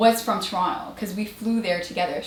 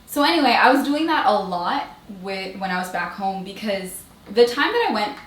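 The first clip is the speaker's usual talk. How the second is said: normal talking voice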